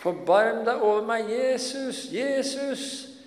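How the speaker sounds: pleading shout to Jesus; calling out loud. Acted. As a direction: call out desperately to someone passing by. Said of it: "begynner han å rope".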